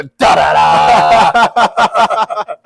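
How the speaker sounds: drawn-out, loud "da da da"; imitated monster fanfare. Podcaster mimicking what he'd go every time, loud drawn-out fanfare. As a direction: belt out a slow villain sting. Imitates the character's sound again.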